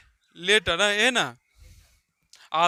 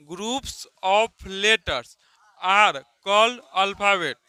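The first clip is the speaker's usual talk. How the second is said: very slow, word by word, for the class to write down; slow dictation; 4 dB louder, much slower than usual. Shown as a demonstration. Teacher to class, dictating the alphabet definition: very slow and deliberate. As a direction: spell out the definition slowly so students can copy it. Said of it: "teacher dictates the definition".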